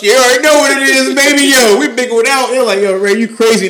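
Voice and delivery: high, loud excited boasting; hyped-up fan. Real, not acted. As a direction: crow over your team's win. Hyped up about the Bengals win.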